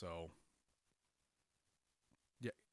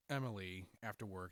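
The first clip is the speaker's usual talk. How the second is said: usual speaking voice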